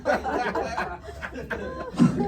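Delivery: high breathy wheeze of laughter; high wheezing giggle. Real, not acted. Laughs at own joke.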